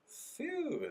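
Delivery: voiced, high-pitched "phew". Shown as a demonstration. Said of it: "Ele usa essa expressão". ("phew" agudo, com voz)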